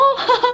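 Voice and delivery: short high giggle; a bright little laugh at herself; high giggle after gushing. Real, not acted.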